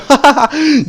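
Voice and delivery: high amused laugh. Real, not acted. Gamer watching enemies get zapped, high laugh. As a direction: giggle at the enemies' failure.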